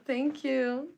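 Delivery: usual speaking voice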